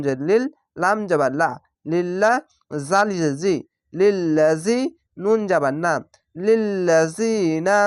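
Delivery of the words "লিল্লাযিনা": লিল্লাযিনা slowly, long "zee" before "na"; one-alif elongation. Shown as a demonstration. Teacher modelling a Quran word, long "zee" in লিল্লাযিনা, slowly. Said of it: "এক আলিফ টেনে পড়বেন".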